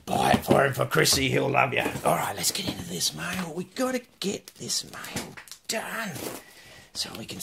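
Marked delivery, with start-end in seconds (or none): none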